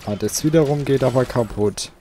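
ordinary talk, nothing marked

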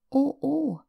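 put-on high, scared cry of a small girl; frightened little girl. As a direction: gasp it out in fear as a child. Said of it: "flüsterte ängstlich Liese". (ängstliches kleines Mädchen, hoch)

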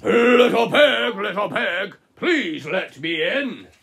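slow, loud wolf at the door; big bad wolf. Wolf calling to the piggies inside, slow and loud. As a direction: draw out each word like a sly wolf.

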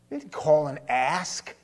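usual speaking voice

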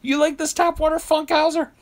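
Larry David mocking the tap water. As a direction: needle him in a high incredulous voice. Larry David needling Funkhauser.

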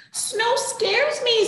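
high, slow, loud fretting kitten; worried kitten. Acted. First kitten telling siblings snow scares it, high fretful. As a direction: fret about the snow as a small kitten.

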